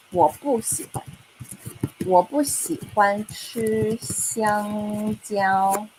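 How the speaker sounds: very slow, each syllable clear; slow model answer. Shown as a demonstration. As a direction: drill it slowly for the class. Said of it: "I'm gonna say".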